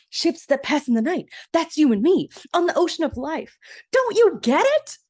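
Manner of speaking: high, loud, over-the-top stepsister reciting; dramatic poem recital. Retold or imitated. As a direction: ham up the poem, rising to a squeal. Minerva recites poem at prince.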